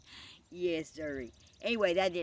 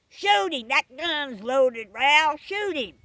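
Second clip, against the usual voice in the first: high, very loud frantic command; furious shouted order; 9 semitones higher, 14 dB louder, slower than usual. Acted. Rastus ordering Ralph to fire the gun, furious shouted order. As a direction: holler the order at a friend.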